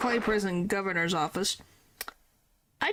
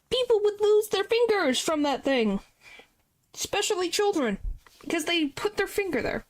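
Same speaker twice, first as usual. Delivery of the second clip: high fussy voice; 9 semitones higher than usual. Acted. Character scolds about clock.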